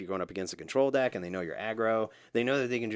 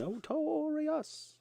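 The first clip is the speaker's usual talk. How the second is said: "Notorious" sung far higher, very slowly stretched; drawn-out high; much slower than usual. Pretend. Drawn-out mock echo of word.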